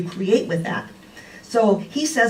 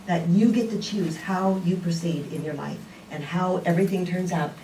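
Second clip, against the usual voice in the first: ordinary talk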